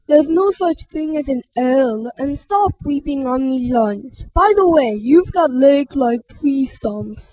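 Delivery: stomp and mock like a bully. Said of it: "Rudy stomped his foot".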